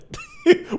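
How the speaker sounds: quick high laugh. Real, not acted. Laughs about Vegeta stuff.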